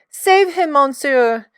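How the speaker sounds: high, loud pleading cry; sobbing plea. A narrator's reading. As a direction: beg the policeman in panic. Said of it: "Suzette Bob sobbed to the policeman".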